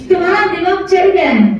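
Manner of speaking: high-pitched scolding, acted indignation; angry accusation. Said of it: "चिल्ला रहा है". (क्रोध में ऊँचे स्वर में उलाहना)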